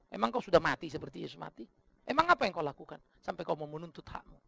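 ordinary talk, nothing marked

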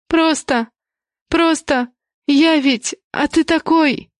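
stumbling over words on the verge of tears; shaken tearful witness. A narrator's reading. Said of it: "сбивчиво говорила Веста, но голос сорвался".

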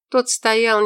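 ordinary delivery

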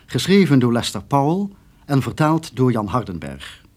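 ordinary delivery

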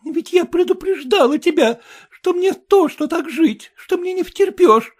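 high, loud, tearful wife; young wife. Acted. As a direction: complain like an upset young bride. Wife's voice.

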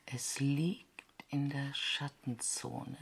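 slow hushed whisper; whispered while peering into the dark. Acted. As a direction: breathe it out, barely audible. Searching dark crater, hushed.

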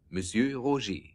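ordinary talk, nothing marked

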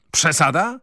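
Przesada? thrown back high and rising; bristling retort. A narrator's reading. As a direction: bristle and fling the word back. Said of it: "zaperzył się Wroński".

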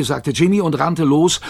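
ordinary delivery, nothing marked

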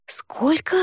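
horrified cry; horrified exclamation. Acted. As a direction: shriek it out in horror. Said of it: "в ужасе воскликнула я".

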